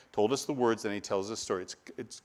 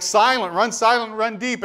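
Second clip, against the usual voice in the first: mock military command; 11 semitones higher, 9 dB louder than usual. Family hides when doorbell rings.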